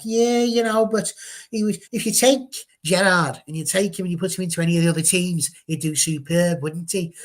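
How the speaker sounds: a mock Scouse accent, playing a Liverpool pundit; hammed-up Scouse accent, starting high and loud, then settling; Jamie Carragher's Scouse accent. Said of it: "tried to do it in the accent".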